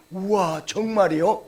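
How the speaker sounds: mock surprise in standard Korean. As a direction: act amazed in standard Korean. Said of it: "표준어는". (표준어로 놀란 척)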